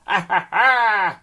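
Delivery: high, slow hammy cackle; witch laugh. Acted. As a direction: fake hag cackle, drawn out. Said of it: "is that a witch laugh? I don't think it was".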